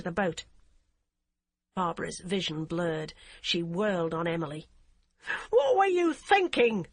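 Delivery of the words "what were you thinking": high, loud slow furious shriek. A narrator's reading. Barbara rounds on the DCI, high loud furious shriek. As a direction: scream at her in fury. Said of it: "she shrieked".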